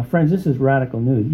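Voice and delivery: ordinary talk, nothing marked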